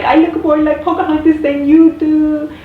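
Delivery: put-on high, loud, tearful whine of a rejected girl; upset girl wailing to a friend about a lost role; imitating a bawling girl. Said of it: "crying, and she's like".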